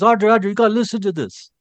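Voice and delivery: quick, high imitated Bostonian; Boston accent. Boston husband urges his wife to listen, quick Boston accent. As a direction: summon her fast in a Boston accent, voice up. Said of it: "He had that Boston accent".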